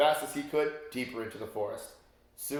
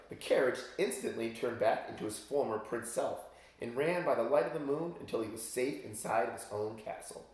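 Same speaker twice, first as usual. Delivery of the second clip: ordinary delivery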